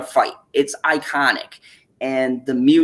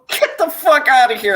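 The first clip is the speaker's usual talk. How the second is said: high, laughing mom line; laughing disbelief; 10 semitones higher, 3 dB louder than usual. Retold or imitated. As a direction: say it through laughter, can't believe it. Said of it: "she goes".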